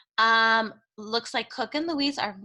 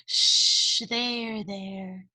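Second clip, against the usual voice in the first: put-on calm her like a crying child; soothing hush; much slower than usual. Louise soothing Cook.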